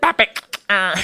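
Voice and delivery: imitated toy carrying on regardless. Comic mimics Bop It after the tantrum, low mechanical command. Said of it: "It was like".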